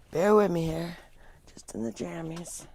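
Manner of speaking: whispered aside, almost unvoiced; soft embarrassed whisper; sheepish whisper. Real, not acted. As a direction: breathe it out apologetically. Apologizing for being unshowered.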